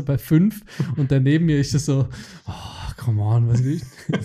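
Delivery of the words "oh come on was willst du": drawn-out, slow impatient "come on"; impatient customer. Retold or imitated. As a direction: sigh it out like a fed-up man waiting. (ungeduldig gedehnt, genervter Kunde)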